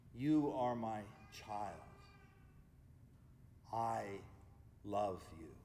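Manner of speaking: low, slow God voice with long pauses. Acted. God speaks within each praying heart, low and unhurried. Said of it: "hear this voice".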